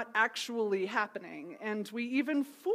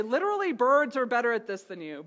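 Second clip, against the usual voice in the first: snarky; 5 dB louder, faster than usual. Acted. Preacher imagines Jesus comparing people to birds, snarky. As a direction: rub it in, louder, with pointed snark. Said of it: "not to be snarky and say".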